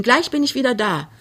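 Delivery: high, cheery call; forced cheerful call. Acted. Daughter leaves to fetch coals, calling cheerfully. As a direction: call back as cheerfully as possible. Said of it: "rief, so fröhlich es ging".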